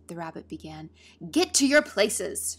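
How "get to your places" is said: slow, high, very loud command; thunderous shout. A narrator's reading. Queen ordering players to places, thunderous shout. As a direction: bellow it like thunder. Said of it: "shouted the Queen in a voice of thunder".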